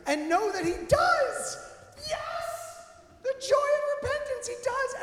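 high, loud burst of preaching joy; exultant. Real, not acted. Preacher celebrating the joy of repentance, exultant. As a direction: cry it out in triumph.

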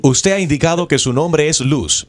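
steady automated recorded-message voice; phone menu voice. Acted. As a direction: read it like a robotic phone menu.

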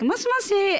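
high, friendly phone greeting; polite high-pitched phone voice. Retold or imitated. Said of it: "it's always very high-pitched and very friendly".

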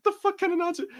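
can't believe this nonsense; mock-outraged rhetorical question; exasperated disbelief. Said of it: "I'm like".

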